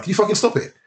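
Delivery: high-pitched retold telling-off; mock exasperated complaint. Retold complaint to noisy neighbours.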